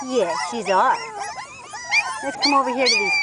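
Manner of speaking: normal talking voice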